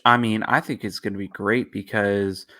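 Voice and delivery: usual speaking voice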